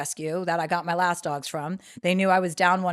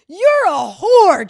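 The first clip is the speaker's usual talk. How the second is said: high, very loud drawn-out insult; imitated screaming; 10 semitones higher, 13 dB louder, much slower than usual. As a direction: hurl it slowly like the screaming man. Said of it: "screaming like that".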